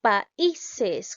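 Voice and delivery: "Países" broken into syllables, I standing out; stress on the I. Shown as a demonstration. Teacher testing which vowel sounds stronger, stressing I in "Países". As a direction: say "Países" syllable by syllable, leaning on the middle I. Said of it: "la vocal cerrada suena más".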